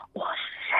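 airy unvoiced whisper; breathy whisper. Shown as a demonstration. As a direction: say it on breath only. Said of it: "就是用这种发气的这种声音". (气声说话)

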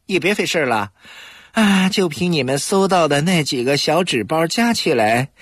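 lazy, mocking contempt; dry disdain. A narrator's reading. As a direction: sneer at the two police. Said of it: "他不屑地说".

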